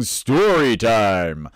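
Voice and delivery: very slow, over-accentuated announcer voice; big, silly announcer flourish; over-the-top announcer. Shown as a demonstration. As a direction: draw out each word like a ridiculous announcer. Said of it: "announcer quality of my voice".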